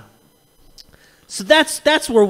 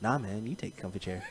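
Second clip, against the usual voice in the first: mock polite refusal; 10 semitones lower, 16 dB quieter than usual. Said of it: "To which Justin's like".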